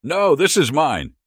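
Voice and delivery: high, loud, drawn-out yell. A narrator's reading. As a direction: yell it like an older woman guarding her prize.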